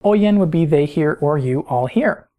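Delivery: usual speaking voice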